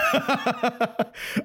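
high, soft giggle; a real bright laugh; laughter. Real, not acted. Laughing at the chill run.

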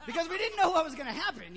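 joking about the emergency snacks, laughing. Real, not acted. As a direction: laugh at the panic buying.

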